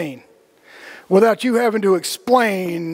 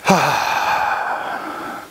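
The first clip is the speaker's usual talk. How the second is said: relieved exhale; 5 semitones lower than usual. Shown as a demonstration. Demonstrating a relaxing breath.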